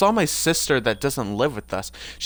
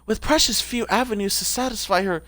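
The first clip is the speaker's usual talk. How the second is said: raised-pitch character voice; Anita; 4 semitones higher than usual. Acted. Streamer reading a character's bio aloud, higher put-on voice. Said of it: "what was Anita's voice?".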